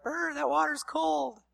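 high, slow mock shiver; shivery complaint. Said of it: "They're gonna be like".

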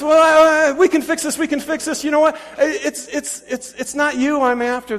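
high, loud, stammering panic; hammed-up desperate bargaining; frantic backpedalling. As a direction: stumble over the words trying to save yourself.

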